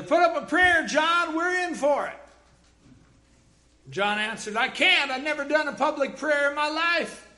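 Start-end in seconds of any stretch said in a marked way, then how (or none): 0.0-2.2 high, loud cry for help; terrified shout. A narrator's reading. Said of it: "one shouted to the other". 4.6-7.3 high, loud, alarmed reply; panicked protest. A narrator's reading.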